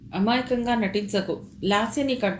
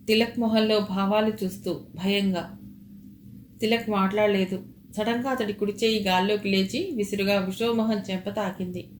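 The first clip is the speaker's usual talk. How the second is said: ordinary delivery, nothing marked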